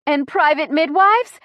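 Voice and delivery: high, loud, strained fragment; pained. A narrator's reading. Chrissy mid-contraction rants to the driver, pained. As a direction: push it out before the next breath. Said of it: "Deep breath".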